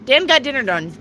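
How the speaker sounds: quick tongue twister run; said fast. Shown as a demonstration. Rushes the tongue twister fast for viewers. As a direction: rattle off "Dan got dinner done" at speed. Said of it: "Say that fast".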